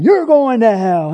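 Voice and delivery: hammed-up street preacher shout; mock yell. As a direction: yell it like an angry picketer. Acted picketer yelling at people.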